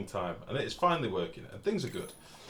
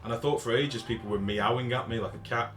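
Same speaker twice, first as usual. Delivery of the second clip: usual speaking voice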